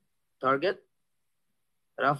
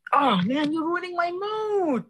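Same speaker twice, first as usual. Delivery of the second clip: mock crying; slower than usual. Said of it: "I'm just joking".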